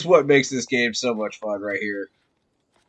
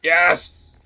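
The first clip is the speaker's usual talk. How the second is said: triumphant; 3 semitones higher, 6 dB louder than usual. Real, not acted. Celebrating a kill.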